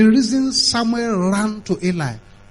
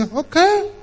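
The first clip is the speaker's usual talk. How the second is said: high-pitched acted okay; keen agreement; 10 semitones higher, 4 dB louder than usual. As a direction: agree like an eager listener, pitched way up. Voicing an eager, obedient listener.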